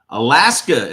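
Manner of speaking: Alaska called out loud with a falling tune; remembering a viewer's home state out loud; loud recall. Real, not acted. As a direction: blurt Alaska out as it comes to mind.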